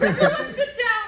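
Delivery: loud cheerful call. Acted. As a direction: call everyone to the table. Hostess calling out, laughing.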